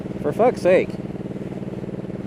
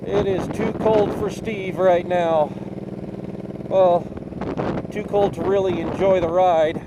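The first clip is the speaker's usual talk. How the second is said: ordinary talk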